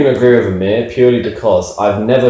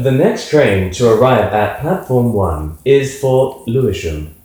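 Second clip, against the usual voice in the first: slow, measured tannoy voice; lift announcement voice; slower than usual. Shown as a demonstration. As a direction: announce it like a lift's recorded voice. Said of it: "He should do the voice for everything".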